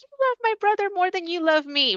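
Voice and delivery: mock childhood protest, pitched high; retold yell at mom. Daughter complaining to her mom about her brother, mock yell. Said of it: "would yell at my mom".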